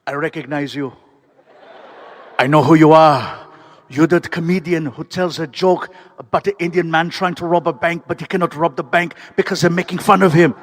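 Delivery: put-on deliberate Austrian-accented recognition; weighty Austrian star placing a familiar face; Arnold Schwarzenegger. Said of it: "and he's like".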